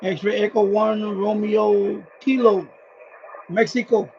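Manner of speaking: high, slow, loud callsign call; radio call-out. Real, not acted. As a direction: call the station clearly over the air. Calling the station on radio.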